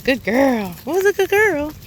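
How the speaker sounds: high, sing-song praise for a puppy. Real, not acted. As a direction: pitch jumps up, bright happy praise. Praising the puppy after walk.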